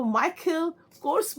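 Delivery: माइकल कोर्स as MY-khul KORS; aspirated k in माइकल, one-syllable कोर्स; variant brand pronunciation. Shown as a demonstration. Vlogger listing how others say the brand, variant pronunciation. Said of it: "कई लोग इसको ... बोलते हैं".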